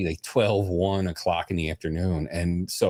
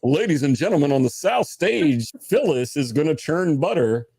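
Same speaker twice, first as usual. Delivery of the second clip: strip club announcer; 6 semitones higher than usual. Pretend. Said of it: "announcer voice is always Reaper".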